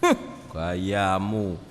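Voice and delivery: mock his big talk; sneering taunt. Imagined Jesus mocking Peter's boast, sneering.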